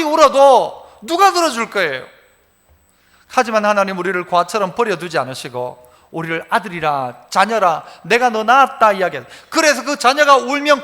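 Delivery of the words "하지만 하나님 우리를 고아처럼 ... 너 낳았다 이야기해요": ordinary delivery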